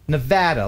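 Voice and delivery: Nevada with the a of cat in the middle; long A. Shown as a demonstration. Host reading out a chat correction, demonstrating Nevada with the cat vowel. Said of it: "long A, ah, as in Nevada".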